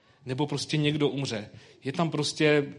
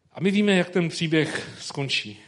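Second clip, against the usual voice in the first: normal talking voice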